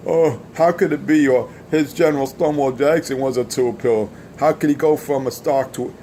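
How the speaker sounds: lightly voiced mock disbelief of the doubters; mocking whiny critic.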